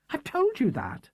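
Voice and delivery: slow, high insistent protest; Matthew, control slipping. A narrator's reading. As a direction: press the point, voice cracking upward. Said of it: "his control suddenly slipping".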